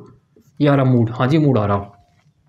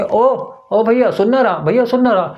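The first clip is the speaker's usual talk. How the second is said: higher, calling a friend close in the ear; 6 semitones higher than usual. Retold or imitated. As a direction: lean in and call him, higher. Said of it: "उसे कान में बोलोगे".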